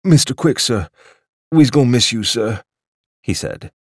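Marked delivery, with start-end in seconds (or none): 0.0-2.6 put-on higher, louder old waiter near tears; a mild tearful old waiter; close to tears. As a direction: plead like a servant losing his master. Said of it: "seemed close to tears".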